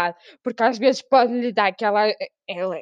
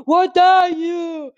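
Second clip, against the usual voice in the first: slow, drawn-out mock chef interrogation, louder; Gordon Ramsay; 7 semitones higher, 6 dB louder, much slower than usual. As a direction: stretch it out like an angry TV chef. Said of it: "os programas de Gordon Ramsay". (imitação lenta e carregada de chef zangado)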